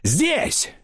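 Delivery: very high one-word call. A narrator's reading. Narrator pointing out the banker's house, calling out high. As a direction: call the stop out, pitch way up. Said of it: "закричал я".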